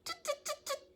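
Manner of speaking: very high, squeaky little tuts; monkey chatter. A narrator's reading. Monkeys shaking fingers back at the peddler, high chattering. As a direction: mimic cheeky monkeys, tiny and high. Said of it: "But the monkeys only shook their fingers back".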